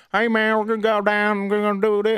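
mocking a Southern guy, hammed-up accent. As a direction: ham up a dumb Southern voice. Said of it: "people have southern accents".

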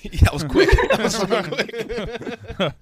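laughing. Real, not acted. Laughing at quick defeat.

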